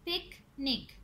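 "Picnic" split into two beats; "picnic" with a gap in the middle; syllable by syllable. Shown as a demonstration. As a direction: say "picnic" as पिक, pause, निक. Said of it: "इसके टू syllables हैं".

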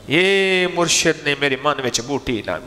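ordinary talk

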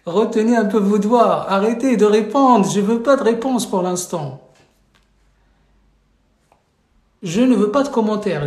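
loud, sharp telling-off of the class; stern scolding. Real, not acted. Teacher scolds students who keep answering, loudly. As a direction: raise your voice and scold them firmly. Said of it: "cette hyperactivité?".

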